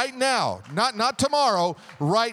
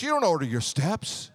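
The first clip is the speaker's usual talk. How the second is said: ordinary talk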